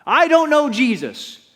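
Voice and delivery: preacher shouting the denial he never made; yelled denial. Shown as a demonstration. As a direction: shout it out as Peter. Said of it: "I've never yelled".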